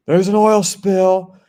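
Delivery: high, loud, drawn-out mock scream; retold scream. Lady screaming at a shopper about a spill, retold scream. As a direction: imitate a woman screaming out a warning. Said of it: "screamed at me".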